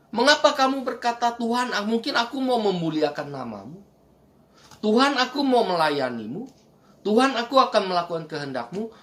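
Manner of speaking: mock pleading prayer. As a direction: pile up the petitions like an eager churchgoer. Voicing pleading followers' prayers.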